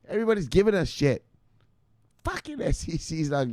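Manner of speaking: high-pitched, exasperated venting; frustrated rant. Real, not acted. Rant about the SEC refusing.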